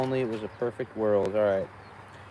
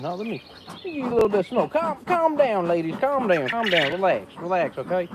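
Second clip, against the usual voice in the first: coaxing the bees; 6 semitones higher, 4 dB louder than usual. Real, not acted. Soothing the bees with smoke.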